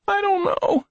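put-on high, thin child voice; high-pitched and pained; weak hurt boy. A narrator's reading. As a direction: answer feebly as an injured boy. Injured boy answers wearily.

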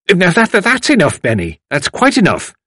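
high-pitched, stuttering cut-in; scolding an old man into stopping; firm woman. A narrator's reading. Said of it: "said a firm-looking woman".

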